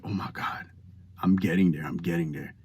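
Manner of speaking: soft, low, half-whispered thrill; hushed self-congratulation. Acted. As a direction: murmur it privately, as if amazed. Acted private thought of nearing goal.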